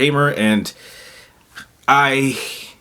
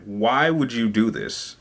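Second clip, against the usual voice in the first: slow, softer shocked question; stunned disbelief; 5 semitones lower, 5 dB quieter, slower than usual. Retold or imitated. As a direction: ask it quietly, as if the news just sank in. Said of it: "And I'm in a state of shock".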